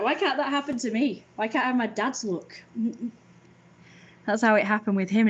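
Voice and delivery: ordinary delivery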